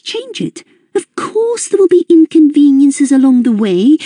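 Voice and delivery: high, chirpy mother dispensing advice; bright and enthusiastic. A narrator's reading. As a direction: cheerfully justify the unthinkable. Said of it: "She spoke brightly, full of enthusiasm".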